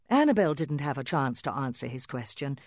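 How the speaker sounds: plain reading voice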